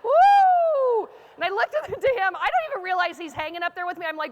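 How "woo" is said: long, high, loud woo; hammed-up whoop from a great height; giddy whoop. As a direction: let out a sustained mock-giddy woo. Acted whoop high on the scaffold.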